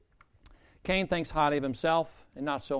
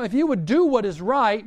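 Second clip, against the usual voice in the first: loud, high emphatic appeal; swooping, forceful exhortation; God exhorting Cain; 6 semitones higher, 8 dB louder than usual. Acted. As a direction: press it home, voice raised. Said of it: "he goes on to say".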